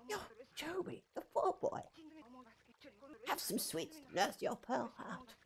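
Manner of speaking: put-on high, hushed little old lady; sweet granny shopkeeper, high and soft. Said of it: "I should do a voice".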